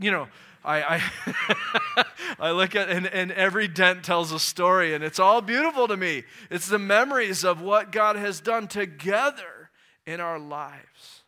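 loud heartfelt preacher voice; impassioned preaching. Acted. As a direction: swell with joy over the story.

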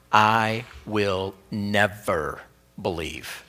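a stubborn disciple refusing to be convinced, slow and low; willful refusal. Retold or imitated. A mildly voiced Thomas refusing to believe, slow and set. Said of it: "willful determination".

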